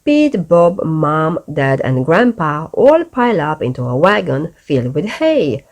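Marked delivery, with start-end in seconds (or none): none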